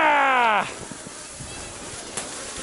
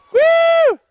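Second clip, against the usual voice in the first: loud, high whoop; cheering whoop; 6 dB louder than usual. Real, not acted. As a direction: whoop them down the hill. Cheering a sledder on.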